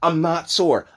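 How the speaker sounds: ordinary talk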